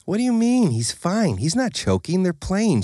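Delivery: brushing off his worry; lightly voiced wife; dismissive wife. A narrator's reading.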